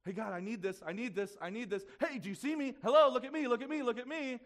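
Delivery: put-on a kid tugging for attention, building to a yell; needy child voice. Pastor mimics believers nagging God, building to a needy shout.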